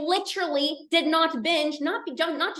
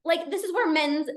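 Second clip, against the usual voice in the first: normal talking voice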